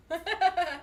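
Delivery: high, loud cutesy hi; squeaky greeting. Real, not acted. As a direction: chirp a cute hello. Greeting viewers, put-on voice.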